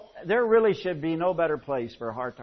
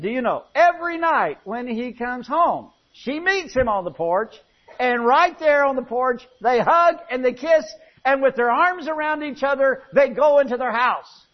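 envious wife; 9 semitones higher, 5 dB louder than usual. Acted. Wife envies the neighbors.

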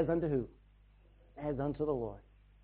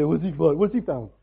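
dumb sheep voice; 7 dB louder than usual. Acted. Preacher voices a following sheep.

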